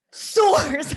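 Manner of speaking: high, loud, drawn-out SOURCE; dramatic announcer. Pretend. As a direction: boom out source like a proclamation. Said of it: "I just can't stop saying it like that".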